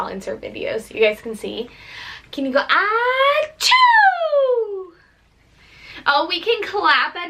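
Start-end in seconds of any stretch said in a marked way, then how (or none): 2.3-4.9 high sing-song sneeze cue "ah, choo"; mock sneeze prompt. Shown as a demonstration. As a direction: coax the baby into sneezing. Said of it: "She'll sneeze".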